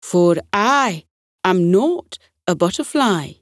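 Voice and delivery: a proud Scottish butterfly objecting; strong Scottish accent. Acted. Little red butterfly tells the meeting he is not a butterfly, strong Scottish accent. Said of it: "he said in a strong Scottish accent".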